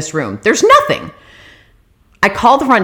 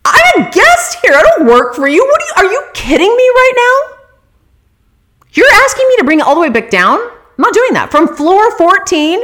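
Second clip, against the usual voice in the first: much higher, louder indignant outburst; angry retort; 11 semitones higher, 4 dB louder than usual. Retold or imitated. Guest telling the employee off about the cart, outraged. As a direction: refuse the clerk, furious and incredulous. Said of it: "I'm just fucking pissed".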